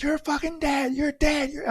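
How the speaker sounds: imitating a frantic, high-pitched yell; retold screaming. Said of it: "I started screaming. I was losing it".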